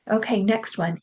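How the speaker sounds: usual speaking voice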